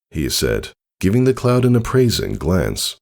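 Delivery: plain reading voice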